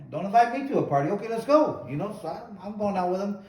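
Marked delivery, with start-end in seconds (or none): 0.1-1.9 louder, higher, eager acceptance of a challenge; cocky comeback. Retold or imitated. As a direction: re-enact jumping at the invitation to fight. Acted reply to a challenge.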